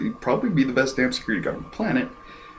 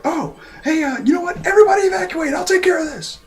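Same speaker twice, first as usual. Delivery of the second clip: very high, loud squawking false bravado; hammed-up high-pitched hero act; mock panicked bravado; 4 dB louder than usual. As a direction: squeal it like a blustering coward taking charge. Voices Hercule clearing everyone out.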